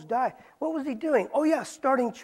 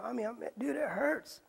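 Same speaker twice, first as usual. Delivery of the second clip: ordinary talk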